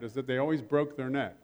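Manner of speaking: normal talking voice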